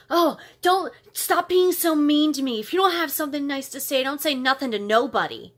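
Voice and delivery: imitating a coworker's loud, high scolding; indignant outburst. Coworker telling the narrator off before storming out, indignant.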